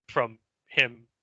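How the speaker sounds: ordinary delivery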